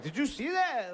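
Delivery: high-pitched bluffing challenge; squeaky-high mock boast; mock bravado. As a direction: play it off in a high voice. Bluffing after punching his car.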